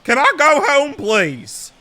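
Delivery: put-on Percy accent. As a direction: voice a confused ballplayer. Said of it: "that's my Percy accent".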